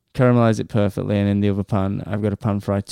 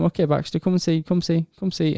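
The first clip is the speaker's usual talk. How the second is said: higher-pitched coaxing, as to a pet; coaxing a dog; 7 semitones higher, faster than usual. Retold or imitated. Owner reassuring his nervous barking dog, high and coaxing. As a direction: gently call the dog over, pitched up.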